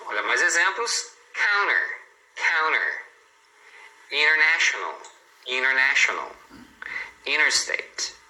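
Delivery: T after N silent, as in "innertain", "prinner", "wanned"; dropped T. Shown as a demonstration. Teacher listing examples for learners, T dropped after N. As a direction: say entertain, printer, wanted with no T after the N. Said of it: "escutando as pessoas falando assim".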